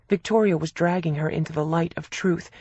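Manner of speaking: plain reading voice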